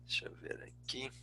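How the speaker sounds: hushed, near-whispered aside; under the breath while searching; muttering to self. Real, not acted. Presenter muttering to himself while hunting for slides, hushed aside.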